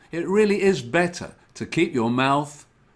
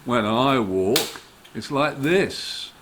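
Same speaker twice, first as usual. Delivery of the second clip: ordinary delivery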